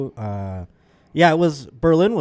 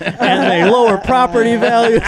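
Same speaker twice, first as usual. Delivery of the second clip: much higher and louder, mock outrage; hammed-up bigot rant; 11 semitones higher, 7 dB louder than usual. Telling the Berlin crowd a joke, hammed-up bigot rant. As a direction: ham it up like a bigot going harder. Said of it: "Then he went harder".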